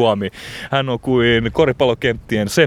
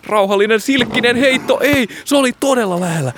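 excited commentary; 7 semitones higher than usual. Pretend. Commentary of a near miss.

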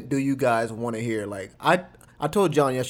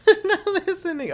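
laughing. Real, not acted. Laughing at partner's joke.

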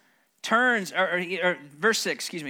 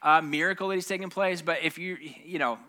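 usual speaking voice

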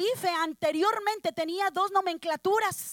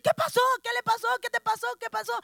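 alarmed father; 6 semitones higher, faster than usual. Acted.

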